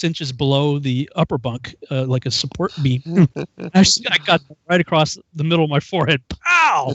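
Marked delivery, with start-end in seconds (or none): 6.3-6.9 high, punchy "pow" sound effect; impact noise. Retold or imitated. Recruit's head hits railing, explosive pow. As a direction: burst it out like a blow landing.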